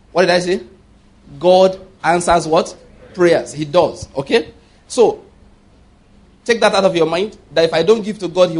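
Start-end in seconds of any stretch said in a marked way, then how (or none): 1.4-3.5 slow call-and-answer teaching; emphatic repetition. Real, not acted. Preacher quizzing the congregation to emphasize the point, slow and deliberate. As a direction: stretch the question, then supply the answer firmly. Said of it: "I need to emphasize that for us".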